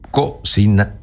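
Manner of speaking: ordinary talk, nothing marked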